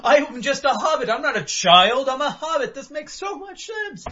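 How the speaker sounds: high, laughing excited voice; giddy realization. Retold or imitated. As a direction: squeal it like a delighted discovery. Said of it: "I was like".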